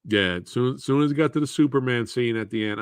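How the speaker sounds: ordinary delivery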